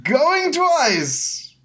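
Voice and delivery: high, drawn-out closing call; auctioneer. Acted. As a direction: stretch out each step of the sale. Auctioneer closes the sale.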